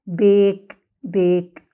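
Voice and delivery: بيك with "ay" as in the letter name A, final E silent; long A by its name. Shown as a demonstration. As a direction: say بيك slowly with a long vowel. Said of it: "ننطق باسم الحرف الأول".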